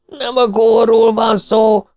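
high, loud, slow yell; full-throated shout. A narrator's reading. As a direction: scream it over the crowd. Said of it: "üvöltött torka szakadtából".

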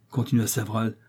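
ordinary delivery

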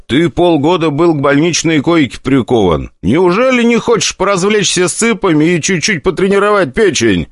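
high, lively tempting tone; playful high persuasion; coaxing. Acted. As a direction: tempt him like a buddy. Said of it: "спросил я, скорее утверждая".

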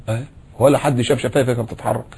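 usual speaking voice

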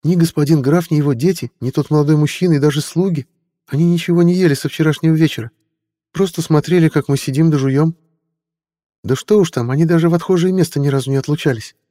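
high eager squire's voice; young boy voice. Acted. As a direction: play the nervous teen squire.

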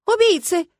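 high, loud cry from the crowd; loud, high-pitched outcry; outraged shout. A narrator's reading. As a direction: shout the accusation out. Crowd shouting after explosion.

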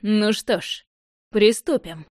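villain with a pleasant smile; charming smile. A narrator's reading. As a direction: announce it sweetly smiling. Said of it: "с очаровательной улыбкой провозгласил".

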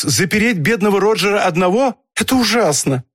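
high-pitched, horrified protest; wife's dismayed outcry, pitched high; distressed cry. A narrator's reading. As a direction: plead against it in alarm. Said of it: "вскричала Мэри Фолкс".

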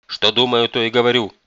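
loud, cutting reply; brusque grandmother shutting down debate; curt retort. A narrator's reading. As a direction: cut the objection dead. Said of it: "отрезала бабушка".

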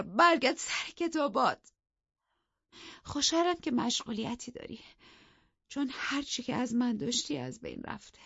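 a touch of cold sarcasm; scornful dismissal. Acted. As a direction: wave him off to his books. Wife dismisses husband coldly.

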